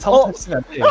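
giggling while saying it again; laughing. Real, not acted. Repeats warning while laughing. (웃으면서 다시 말하기)

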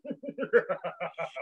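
low, villainous chuckle; evil bear laugh. A narrator's reading. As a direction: laugh wickedly, low and soft. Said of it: "The evil bear laughed at them".

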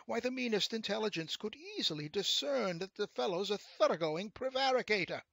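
high, loud, pompous outrage; indignant gentleman. A narrator's reading. Gentleman denounces the rascal to the devil, indignant. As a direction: build to an affronted denunciation.

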